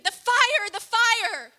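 screaming the fire in panic; retold scream. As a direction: shout it as a dying man in terror. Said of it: "he would scream".

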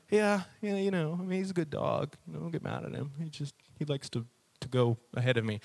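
small, subdued, defensive mumble; sheepish excuse. Retold or imitated. Owner excusing his pulling dog to the trainer, sheepish. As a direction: trail off softly, making excuses.

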